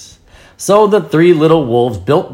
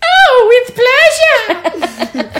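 put-on very high, slow, giggly girl voice; girly flamingo voice; much slower than usual. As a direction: gush it out in a girly falsetto. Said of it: "你要很girly的声音".

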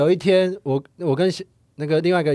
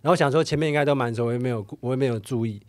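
normal talking voice

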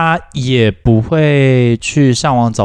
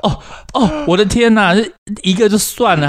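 higher-pitched imitation of a weary mother; exasperated mom; 9 semitones higher than usual. Host imagines mom's weary inner groan, higher mock voice. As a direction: sigh it out like a fed-up mom, pitched up.

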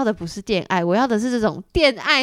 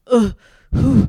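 mock heavy breath, a louder exhale; imitated panting; 5 dB louder than usual. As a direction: puff out a breathy hah like he's panting. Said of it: "他已经在喘气了". (模仿喘气声)

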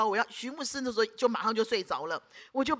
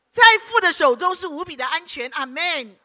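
loud proclamation; 4 semitones higher, 10 dB louder than usual. Real, not acted. Climactic proclamation to congregation.

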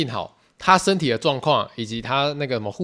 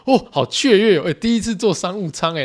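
higher and louder, giddy; excited; 6 semitones higher, 4 dB louder than usual. Retold or imitated. Mother and son thrilled by first business class, higher, louder excitement. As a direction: squeal like a kid on a treat.

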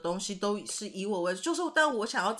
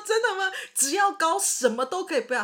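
higher, disbelieving question; incredulous; 9 semitones higher than usual. Retold or imitated. Asking her friend if height alone matters, higher incredulous tone. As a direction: ask in amused disbelief. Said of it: "然后我就说".

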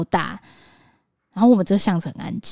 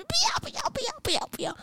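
much higher, softer whiny refusal; mother imitating her toddler's tearful refusals, whiny; imitating crying child; 10 dB quieter than usual. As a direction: mimic a sobbing toddler saying no. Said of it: "他继续哭".

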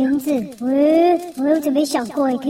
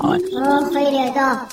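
ordinary talk

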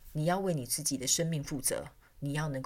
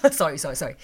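laughing; 4 dB louder, faster than usual. Real, not acted. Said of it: "不好意思，打了个嗝".

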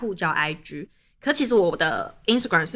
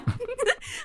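laugh; 6 dB quieter than usual. Real, not acted. Laughing at his messages.

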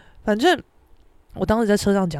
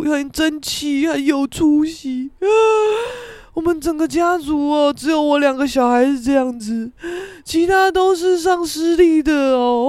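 imitated emotional sighing; 5 semitones higher, 3 dB louder than usual. Father marvelling at his children's schools, hammed-up emotion. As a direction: draw the boasts out, mock-weepy. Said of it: "我爸妈就开始很感慨".

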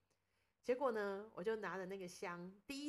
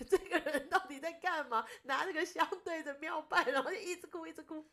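laughing friend's quote; 8 semitones higher, 8 dB louder than usual. Retold or imitated. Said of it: "朋友也想说".

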